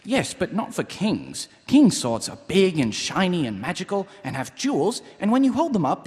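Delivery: ordinary talk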